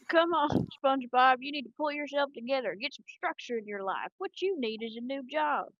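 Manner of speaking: put-on Texas squirrel accent. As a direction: lecture in a cartoon Texas drawl. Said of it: "best Texas squirrel accent".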